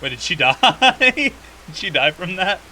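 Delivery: high, loud giggly question; can't believe it, laughing; laughing disbelief. Real, not acted.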